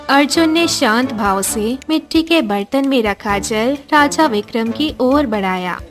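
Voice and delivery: usual speaking voice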